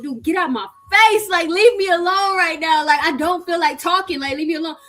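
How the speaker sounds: high, loud telling him off; a fed-up snap at the pest; mock angry brush-off. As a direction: shoo him away, fed up.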